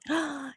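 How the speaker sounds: quick audible intake of breath; surprised gasp. Real, not acted. Listener hearing of a General Hospital return, surprised gasp. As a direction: gasp at the news.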